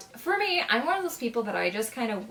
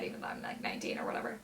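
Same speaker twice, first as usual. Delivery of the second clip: breathy secret aside, barely voiced; whisper; 13 semitones lower, 8 dB quieter, faster than usual. Real, not acted. Vlogger confessing her age to viewers, whispered.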